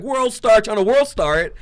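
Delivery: loud, high crowd call; excited onlookers. Retold or imitated. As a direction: imitate bystanders hollering while filming a fight. Imitating onlookers filming the fight.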